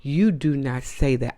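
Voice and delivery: ordinary talk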